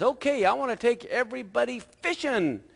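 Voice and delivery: high, hearty storybook grandpa; lightly acted grandpa; Grandpa announcing. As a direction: announce a treat to the kids. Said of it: "Grandpa says".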